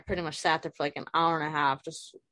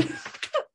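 embarrassed giggle. Real, not acted. Embarrassed by video glitch.

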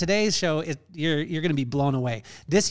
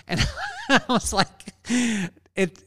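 laughing; 8 semitones higher than usual. Real, not acted. Laughing at daughter's retort.

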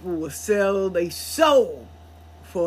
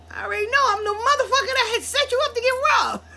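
imitating friend; 14 semitones higher, much faster than usual. Voicing friend's confession.

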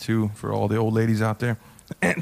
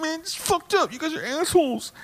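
very high, whimpering caricature; crying mad, imitated; 3 dB quieter than usual. Upset man scolding the audience, mock crying mad. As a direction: wail it in a mock tearful squeak. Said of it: "he was like crying mad".

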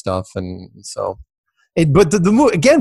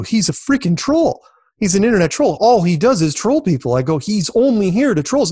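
retold rant; 8 semitones higher than usual. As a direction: replay your own protest, pitched up and worked up.

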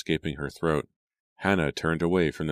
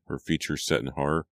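ordinary delivery

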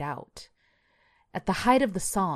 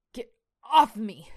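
raised, louder, slow command; forceful order; 5 semitones higher, 5 dB louder than usual. A narrator's reading. Shane orders the cat off his chest, forceful. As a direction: push it out slowly, pitched up.

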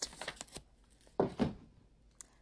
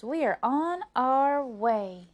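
triumphant; 6 semitones higher, 7 dB louder, slower than usual. Acted. Rose glad to set off.

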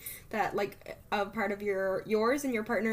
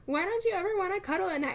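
higher, fast imitation of the partner; playfully quoting the partner, pitched up; imitating partner; 11 semitones higher, faster than usual. Quoting partner's complaint in bed.

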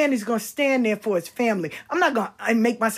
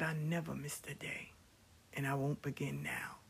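whispering the husband's promise; soft whispered romantic line; retold whisper; 6 semitones lower, 15 dB quieter than usual. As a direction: breathe it like a secret vow.

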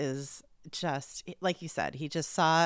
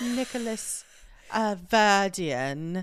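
higher, drawn-out mock-posh English; cut-glass English accent; 3 semitones higher, 5 dB louder, slower than usual. Co-host as the man querying his real name, mock cut-glass English accent. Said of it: "it's called a cut glass English accent".

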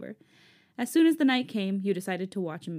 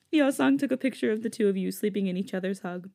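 ordinary talk

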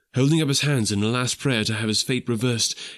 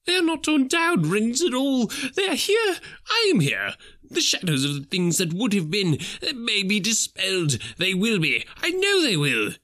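much higher giddy rejoicing; Scrooge, overjoyed; 12 semitones higher than usual. Acted. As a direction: bubble over with relief.

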